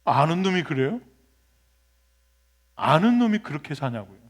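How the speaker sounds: ordinary talk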